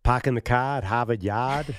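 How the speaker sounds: broad Boston, no r's anywhere; Boston accent. Shown as a demonstration. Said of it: "can you do a Boston accent".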